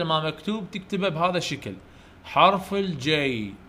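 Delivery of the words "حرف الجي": drawn-out, measured naming; slow letter drill. Shown as a demonstration. Teacher naming the letter J for young pupils, slowly. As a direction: stretch it out for pupils to repeat.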